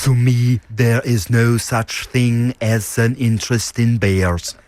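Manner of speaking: slow, German-tinged deadpan; Herzog impression. Co-host asked to blend voices into Herzog, German accent. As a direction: speak it like Werner Herzog. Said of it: "do an impression of this accent".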